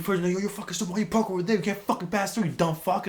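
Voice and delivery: high-pitched mock tirade; yelling insults. Hothead dad yelling at the parked truck driver, angry. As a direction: imitate an angry driver telling someone off. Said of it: "He's, like, yelling at the guy".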